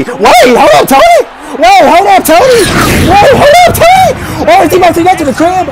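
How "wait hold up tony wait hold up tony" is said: climbing into high-pitched disbelief; building excitement. Real, not acted. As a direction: repeat it faster and more frantic as the runner breaks free. Urging runner on during live return.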